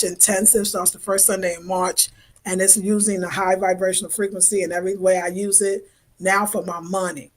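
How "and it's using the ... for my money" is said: ordinary talk, nothing marked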